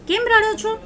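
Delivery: high, worried voice; concerned question. A narrator's reading. Sparrow asking the crying tree, high and concerned. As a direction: ask gently, pitched high.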